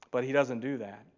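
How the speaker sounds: ordinary talk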